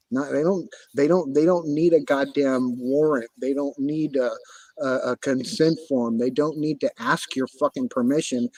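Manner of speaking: ordinary talk, nothing marked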